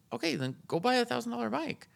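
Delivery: high, emphatic exasperation; exasperated high voice. Retold or imitated. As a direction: throw up your hands, high. Retold exasperated answer.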